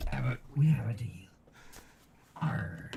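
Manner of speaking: loud fast pirate growl. Pretend. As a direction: growl like Barbosa. Pirate Barbosa impression.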